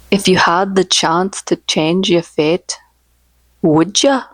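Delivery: slow, lightly Irish "would ya"; a bad Irish accent, "would ya" stressed; wrong Irish take. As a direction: lean on the "ya" in a mock Irish lilt. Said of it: "this is one that I say wrong".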